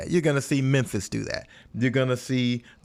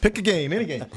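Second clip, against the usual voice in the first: carnival barker; 9 semitones higher, faster than usual. Pretend. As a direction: hawk it like a fairground barker. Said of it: "I sound like a carnival, carnival barker".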